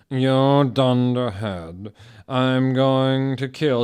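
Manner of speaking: slow, drawn-out sneer; hammed-up Snape. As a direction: sneer it like the potions master. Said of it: "shooted Snape".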